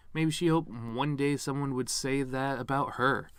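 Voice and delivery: usual speaking voice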